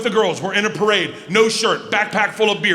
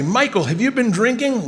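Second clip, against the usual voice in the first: higher-pitched but milder quoted rebuke; quoted scolding; 5 semitones higher than usual. Retold or imitated. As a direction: quote the scolding without shouting. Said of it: "When I say".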